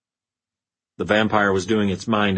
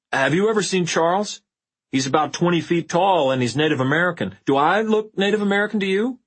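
higher, panicked babble; panicked babbling; 6 semitones higher than usual. A narrator's reading. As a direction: babble in panic, rising pitch. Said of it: "continued to babble".

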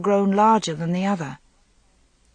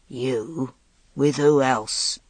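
lower, slow contemptuous sneer; 4 semitones lower, much slower than usual. A narrator's reading. Giant mocking the slender knight, a low sneer. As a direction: scorn him, drawn out and low. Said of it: "sneered Meleagant".

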